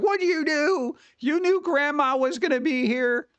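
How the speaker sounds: a high, put-out relative scolding; mock a shocked aunt telling him off; a hammed-up relative scolding at Thanksgiving dinner. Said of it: "Be like".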